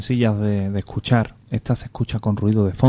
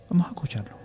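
whispered; 3 semitones higher, 7 dB quieter, much faster than usual. Acted. Spooky lead-in to clip.

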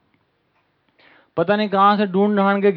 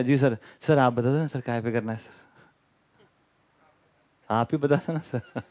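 meek yes-man; 5 semitones lower, 5 dB quieter than usual. Retold or imitated. Acted meek yes-man asking boss.

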